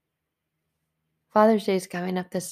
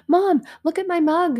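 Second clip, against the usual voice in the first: excited daughter; 9 semitones higher, 6 dB louder than usual. Retold or imitated. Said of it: "she's showing me".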